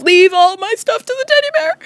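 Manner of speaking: high, loud last-will whine; mock doomed wail. Boy facing angry boyfriend, mock doomed wail. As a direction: wail it like your final words. Acted panic before being beaten.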